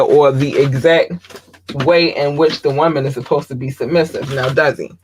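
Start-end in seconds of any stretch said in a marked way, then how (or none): none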